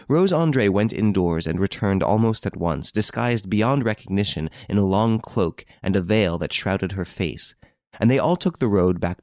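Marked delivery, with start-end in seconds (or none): none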